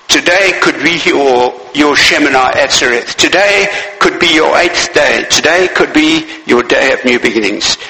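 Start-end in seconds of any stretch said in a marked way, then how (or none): none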